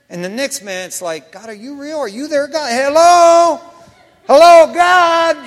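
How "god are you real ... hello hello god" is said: calling out. Retold or imitated. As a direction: shout up into silence, unsure anyone hears. Doubting believer calling to God.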